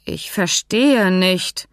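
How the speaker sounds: sehr langsam, Wort für Wort. Acted. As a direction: begriffsstutzig gedehnt. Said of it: "sagte Hans langsam". (Hans sagt es langsam und verständnislos zu Fink)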